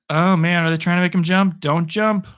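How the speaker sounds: ordinary talk, nothing marked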